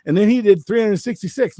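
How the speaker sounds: usual speaking voice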